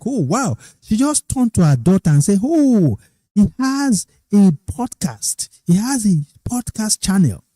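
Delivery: high, delighted voice, imitating the lady; a mother telling her daughter, high and thrilled; excited. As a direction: gush over the news in a high voice. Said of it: "she was very excited".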